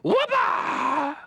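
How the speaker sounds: very high, loud squeaky creature shout; a mole hair yelling its cry; talking mole. Retold or imitated. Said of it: "It was, like, talking to me".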